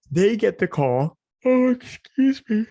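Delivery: real yawn stretching the words high and loud. Real, not acted. Vlogger apologizing because it's so late, yawning. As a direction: talk right through a big yawn. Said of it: "I'm sorry. It's so late".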